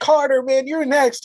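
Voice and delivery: high mock-panicked kid voice; Billy. Billy warning Carter he is next, mock little-kid panic. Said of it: "you can hear the panic".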